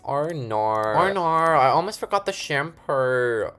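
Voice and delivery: a hint of an Australian accent, slow; lightly put-on Australian voice. Joking mock Australian accent to viewers. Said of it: "Every time I do the Australian accent".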